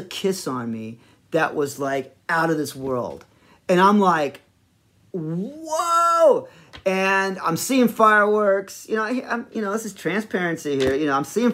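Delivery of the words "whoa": high, drawn-out cry of wonder; re-enacted awestruck "whoa"; amazed whoa. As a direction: blown away, voice soaring up. Reacting to the amazing kiss.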